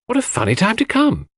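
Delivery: high, lilting surprise; a young girl amused at the odd hour. A narrator's reading. Cynthia remarks on the doctor's late visit, surprised.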